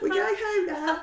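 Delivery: high, loud, drawn-out fake whine; hammed-up tearful whine, laughing through it; mock wail. Frankie announcing the trip home, mock wail. Daughter's wailing reply continues.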